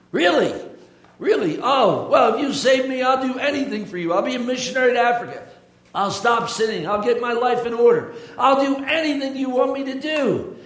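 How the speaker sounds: high, loud, frantic promises; desperate pleading. Acted. Bob hanging off a cliff, begging God in a high loud rush. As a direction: beg to be saved, promising everything.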